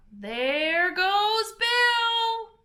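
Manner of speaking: high, long drawn-out shout; crowd shout. A narrator's reading. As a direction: cheer him off into the sky. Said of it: "She heard everyone shout".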